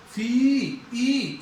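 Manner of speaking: फी and ई with a held ee; long vowel demo. Shown as a demonstration. Teacher isolating the long ई sound, held vowel. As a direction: stretch the ee on फी, then ई alone. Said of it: "ई की long sound जा रही है".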